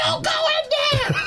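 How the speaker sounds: high, slow warning shout at screen; horror audience yell. Retold or imitated.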